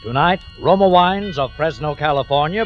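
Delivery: ordinary talk, nothing marked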